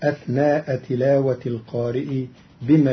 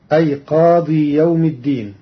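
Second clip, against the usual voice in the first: normal talking voice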